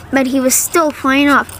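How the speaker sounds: plain reading voice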